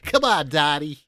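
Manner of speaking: lightly voiced comic character impression; Pee-wee. Co-host riffing for a laugh, practiced character impression. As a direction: put on a hint of a cartoonish man-child. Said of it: "that's a good Pee-wee".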